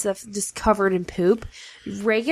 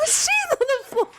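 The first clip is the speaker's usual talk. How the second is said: mock crying. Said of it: "I heard how broken you were".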